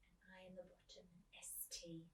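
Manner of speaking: hushed aside; soft unvoiced aside to listeners; whispering. Real, not acted.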